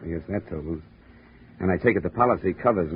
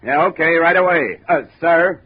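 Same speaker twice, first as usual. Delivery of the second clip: loud, higher call back up to the captain; raised voice answering from inside; shouting back; 9 semitones higher, 10 dB louder than usual. Acted. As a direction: answer the boss's call at full voice.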